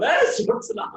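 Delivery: bewildered rookie looking for someone; puzzled. Retold or imitated. Young doctor staring at the wall: startled, high.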